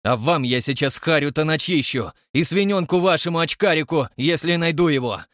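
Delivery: loud, furious threat; angry threat. Acted. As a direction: threaten them hotly. Krymov threatens in quarrel.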